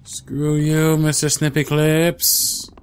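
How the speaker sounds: slow, loud, triumphant yelling; defiant shout. Real, not acted. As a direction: shout your freedom at it. Defiant escape from monster.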